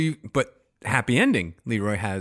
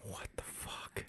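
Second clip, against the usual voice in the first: slow, whispered disbelief; stunned whisper; 20 dB quieter, much slower than usual. Real, not acted. Cohost reacts to the endless hold, stunned whisper. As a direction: whisper it slowly, appalled.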